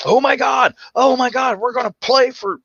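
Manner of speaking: very high-pitched frantic squeal of excitement; over-excited dog. Acted. Dog spotting a playmate on a walk, wildly over-excited.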